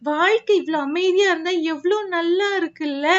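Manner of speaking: ordinary talk, nothing marked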